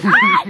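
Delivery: high shrieking laugh; laughing scream. Real, not acted.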